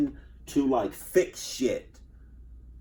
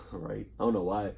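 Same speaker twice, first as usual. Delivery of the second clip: normal talking voice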